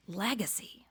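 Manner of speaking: breathy whisper of Legacy. Real, not acted. Speaker to audience, whispering the key word.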